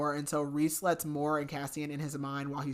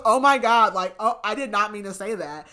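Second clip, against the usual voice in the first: loud, higher, startled gasp of a line; shocked; 4 semitones higher, 8 dB louder than usual. Retold or imitated. Nesta shocked at what she just said, loud and startled. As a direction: caught off guard by her own words.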